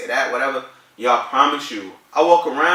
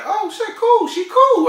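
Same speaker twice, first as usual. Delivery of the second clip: high-pitched, excited aside; retold excitement; 4 dB louder, slower than usual. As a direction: replay the thrilled reaction way up high. Retold relief at her hello.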